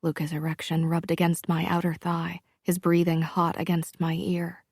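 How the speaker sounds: usual speaking voice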